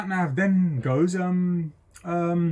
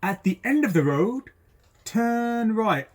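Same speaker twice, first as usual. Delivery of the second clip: higher, lilting navigation prompt; sat nav voice; 6 semitones higher, slower than usual. Retold or imitated. Instructor mimicking the sat nav at the junction, lilting and higher. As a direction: sing it out like a recorded sat nav. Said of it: "so the sat nav goes off again".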